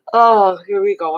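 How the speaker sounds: talking through a yawn. Real, not acted. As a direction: yawn as you speak. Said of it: "That's why I'm yawning".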